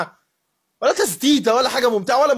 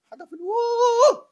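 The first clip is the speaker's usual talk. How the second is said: mock wooo; slower than usual.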